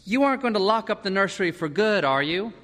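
put-on high-pitched boy pressing his father; wary young son. A narrator's reading. Peter questions his father.

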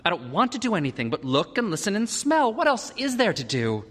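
put-on high-pitched boy's protest; spoiled child objecting; petulant son. A narrator's reading. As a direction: whine it at the father. Peter protests to father.

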